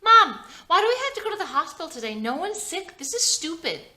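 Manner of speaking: put-on high child voice, fast and loud; a bored kid whining at mom; whiny kid. Young boy complaining to his mom about the hospital trip. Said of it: "child questioning mom".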